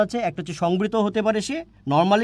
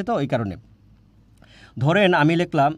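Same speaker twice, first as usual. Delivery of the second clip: ordinary talk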